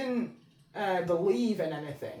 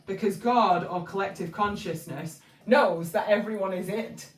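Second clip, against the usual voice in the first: ordinary delivery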